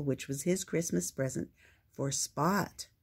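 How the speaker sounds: usual speaking voice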